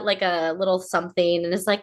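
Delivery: ordinary talk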